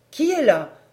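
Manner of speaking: high, loud call into the dark; wary servant. Acted. Servant calling down to a night visitor, high and loud. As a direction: call down the stairs, startled. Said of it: "fit-elle en avançant sa lanterne".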